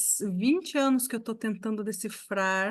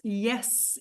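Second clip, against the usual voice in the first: normal talking voice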